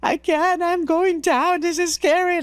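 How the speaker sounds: high-pitched panicky whine, retold; mock crying. Scared climber telling the guide she's done, mock crying. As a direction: wail it like giving up, hammed up. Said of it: "I'm like, I'm crying".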